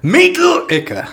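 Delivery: Meat liquor yelled high, slow and loud; very loud shout. Shown as a demonstration. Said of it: "can you do a very loud L?".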